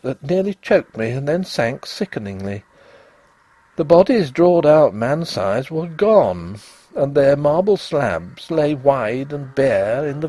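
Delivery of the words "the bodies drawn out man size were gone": ordinary delivery, nothing marked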